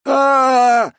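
long loud screaming cry; agonized scream. A narrator's reading. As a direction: scream in agony. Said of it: "орало тело".

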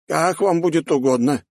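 high, deferential assent; obey gravely in a high old mage's voice; serious old mage. A narrator's reading. Mage accepts his lord's secret order, serious high voice. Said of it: "серьёзно кивнул тот".

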